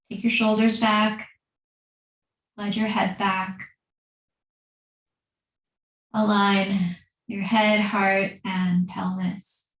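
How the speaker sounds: calm, unhurried yoga teacher voice with long pauses; guided meditation. Acted. Leads seated breathing meditation.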